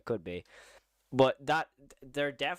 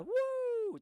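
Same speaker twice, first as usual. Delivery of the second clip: high woo. Retold or imitated. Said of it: "that's not like".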